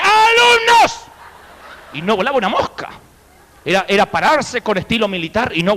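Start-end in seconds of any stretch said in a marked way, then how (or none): 0.0-1.0 long, high, stretched-out call to the class; drawn-out commanding yell; teacher's shout. Retold or imitated. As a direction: bellow it like a strict schoolteacher. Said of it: "decía".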